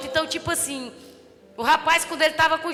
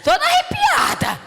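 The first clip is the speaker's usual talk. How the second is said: thrilled exclaim; 14 semitones higher, 6 dB louder, slower than usual. Retold or imitated. Retold thrill in church.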